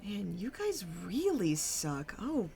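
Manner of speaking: preen with swooping, theatrical pitch; theatrical villain Mauve. Acted. Mauve gloats to the troupe after their performance, theatrical swoops. Game master voices Mauve.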